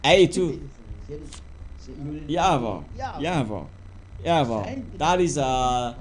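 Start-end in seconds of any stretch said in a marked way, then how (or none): 2.3-4.8 Yavo repeated low and soft, with open ah; pronunciation demo. Shown as a demonstration. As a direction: model Yavo quietly, several times over. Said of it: "and you pronounce".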